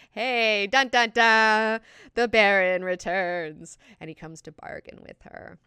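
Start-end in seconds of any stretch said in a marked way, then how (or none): 0.1-3.8 dramatic announce. Retold or imitated. Dramatic Baron entrance.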